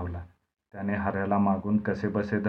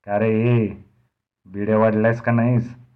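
louder bitter taunt; बिड्या वाढल्यास का नाहीस said with louder, sharp bitterness; bitter demand; 7 dB louder than usual. Acted. Dnyanu turns on Harya about the bidis, bitter and loud. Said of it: "कडवटापणाने म्हणाला".

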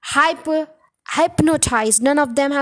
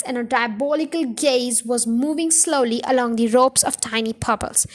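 usual speaking voice

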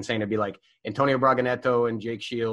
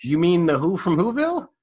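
confused question; 7 semitones higher, 5 dB louder than usual. Retold or imitated. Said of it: "I'm going".

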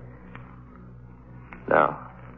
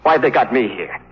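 higher and louder, rising to hysteria; frantic, terrified he'll be next; young man cracking under fear of being killed, panicked and loud; panicked outburst; 9 semitones higher, 4 dB louder than usual. Acted. Frightened patient breaking down.

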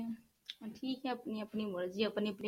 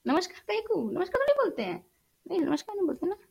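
much higher, amused disbelief at her own word; incredulous; 9 semitones higher, faster than usual. Real, not acted. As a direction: scoff at her own namaskar, pitched up. Correcting herself, incredulous. (ऊँची आवाज़ में हैरानी)

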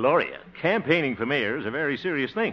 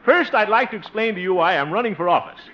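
high, loud stump speech; campaign oratory; 8 semitones higher, 5 dB louder than usual. Pretend. Candidate rehearsing his speech to voters, speechifying. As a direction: project to a rally, starting high and loud.

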